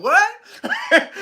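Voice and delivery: squeaky high startled what with a laugh; shocked what. Retold or imitated. Hearing the aura reading, high laughing shock. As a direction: jump way up in disbelief.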